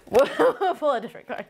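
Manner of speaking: words through giggles; laughing as she speaks. Real, not acted. Laughing while pulling card.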